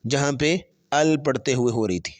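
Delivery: usual speaking voice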